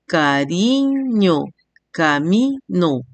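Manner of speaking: "cariño", "camino" slowly, syllables drawn out; split into syllables. Shown as a demonstration. Teacher giving three-syllable examples, each syllable drawn out. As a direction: stretch "cariño" and "camino" beat by beat. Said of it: "tienen tres sílabas".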